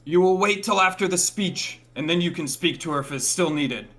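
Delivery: firm gatekeeper voice; stern guard. Acted. Guard blocks Aldrum at the gate.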